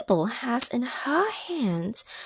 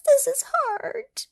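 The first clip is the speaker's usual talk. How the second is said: very high, louder little voice; high strained Mabel; 4 dB louder than usual. Acted. Mabel halfway up the mountain, high and louder. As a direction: squeak it out, worn from climbing.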